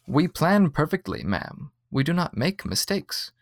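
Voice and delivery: calm the customer like a slightly robotic clerk; soothing android voice, lightly done. A narrator's reading. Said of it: "a voice that was supposed to be soothing".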